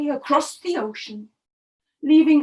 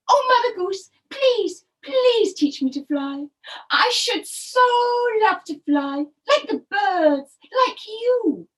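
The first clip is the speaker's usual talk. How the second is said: high, louder begging; pleading turtle; 5 semitones higher, 4 dB louder than usual. Acted. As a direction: ask sweetly and earnestly. Turtle tries again, pleading.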